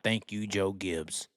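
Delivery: soft, slow sneering mimicry of fans; mock them quietly and slowly; mocking crybaby. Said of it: "crybaby shit about".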